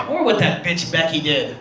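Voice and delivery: usual speaking voice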